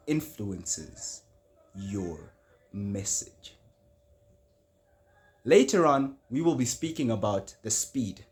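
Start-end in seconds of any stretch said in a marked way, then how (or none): 0.1-3.6 slow, sinking to low and soft; grave emphasis. Shown as a demonstration. Speaker tells audience how tone influences the message, grave and slow. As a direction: draw each word out gravely for weight.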